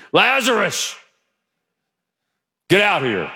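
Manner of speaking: Lazarus called out high and loud, to scare the church; scary loud call. Shown as a demonstration. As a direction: bellow Lazarus out of the tomb. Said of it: "I want to scare you".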